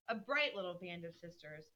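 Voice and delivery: ordinary talk, nothing marked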